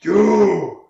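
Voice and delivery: loud, high-pitched ogre bellow; ogre roar. Acted. Game master as ogre charging at player, roaring.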